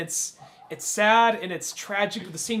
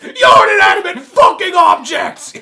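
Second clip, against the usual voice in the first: high, very loud raging yell; hammed-up raging shout; mock furious scream; 11 semitones higher, 16 dB louder than usual. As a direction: scream it at him in fury. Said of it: "He says".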